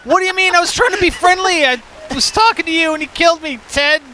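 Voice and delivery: high, loud gamer's complaint; indignant outburst. Real, not acted. Killed by Ted in game.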